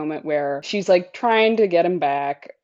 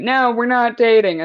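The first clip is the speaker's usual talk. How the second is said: mock flustered denial, a bit louder; sputtering no, we're not dating; 5 semitones higher, 5 dB louder than usual. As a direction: protest a little too fast. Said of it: "a flustery".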